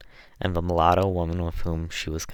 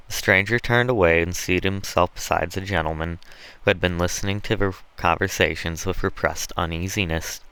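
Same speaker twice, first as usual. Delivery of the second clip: ordinary delivery, nothing marked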